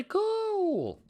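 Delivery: high squeal of delight; bursting with glee at the win; thrilled little shriek; excited. Real, not acted. Excited reaction to profit.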